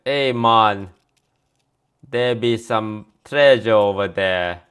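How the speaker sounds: put-on light Jamaican-style troll accent, slow and loud; a mock Jamaican troll accent, laid-back. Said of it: "a troll accent is essentially a Jamaican accent".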